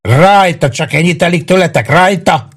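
high, insistent challenge; shouted egging-on. A narrator's reading. Maggio challenges his attackers as they beat him, high. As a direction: egg them on in a high cry.

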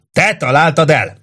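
high-pitched yelled question; shouting. A narrator's reading. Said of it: "ordította Grenelli".

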